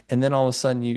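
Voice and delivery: ordinary talk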